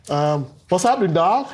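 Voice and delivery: a hint of American street slang; mock American accent. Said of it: "have a nice little conversation in American".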